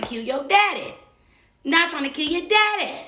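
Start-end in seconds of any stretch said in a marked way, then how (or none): 1.6-3.0 higher, louder pleading repeat. Retold or imitated. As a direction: push the repeat up, exasperated. Repeating plea to partner's kids.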